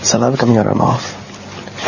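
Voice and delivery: ordinary delivery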